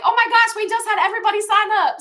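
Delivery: high, loud gushing delight; excited squeal. Retold or imitated. Coach imagining Miss Juanita thrilled at sign-ups, high excited squeal. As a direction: squeal it like the happiest recruiter. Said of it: "Miss Juanita should be over here like".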